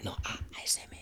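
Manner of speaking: very soft whispered correction; ASMR whisper. Shown as a demonstration. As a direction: demonstrate it in a bare whisper. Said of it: "hay que hablar bajito". (susurro muy bajito de ASMR)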